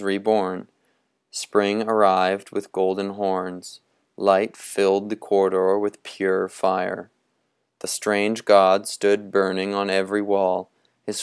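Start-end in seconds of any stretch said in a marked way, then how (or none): none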